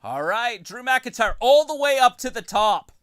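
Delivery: loud ringside play-by-play; wrestling commentator. Acted. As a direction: build it up like a match call. Play-by-play resumes.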